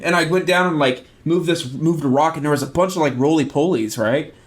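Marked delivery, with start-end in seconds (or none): none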